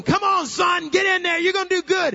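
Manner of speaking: high, sustained full-volume yell; scream. Retold or imitated. Dad urges benched son into the game, screaming. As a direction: scream encouragement from the stands. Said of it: "I'd scream".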